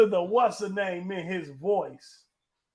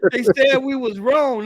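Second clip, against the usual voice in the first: loud, high, triumphant crowing; hyped-up gloating; 7 semitones higher, 10 dB louder than usual. Real, not acted. Boasting to Pastor about winning the bet, loud and hyped. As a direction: rub it in, voice up and loud.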